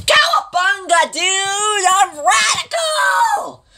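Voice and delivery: high, shouted surfer dude voice. Acted. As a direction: yell it like a pumped-up surfer.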